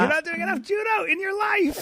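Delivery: high-pitched imitation of a nagging mom; mock motherly yelling. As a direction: shriek it like a scolding mother. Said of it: "yelled at me for, like, twenty minutes".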